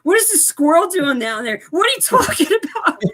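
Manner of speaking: very high, rising disbelief breaking into laughter; mock baffled. Character baffled by the squirrel, high laughing disbelief. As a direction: squeak out the confusion, laughing.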